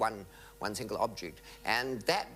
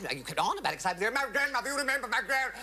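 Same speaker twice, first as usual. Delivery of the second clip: manic; 11 semitones higher, much faster than usual. Retold or imitated. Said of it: "she got manic, too".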